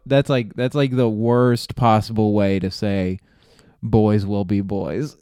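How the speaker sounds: ordinary talk, nothing marked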